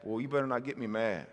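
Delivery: mock parent threat. Preacher imitating a parent warning kids, lightly menacing. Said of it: "You ever tell your kids that?".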